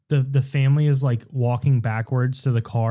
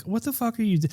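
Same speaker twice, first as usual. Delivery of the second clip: high, squeaky outraged yell; a panicky parent losing it; mock freaked-out parent; 9 semitones higher, 4 dB quieter, faster than usual. Kids' parents yelling at the son. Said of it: "and they're like".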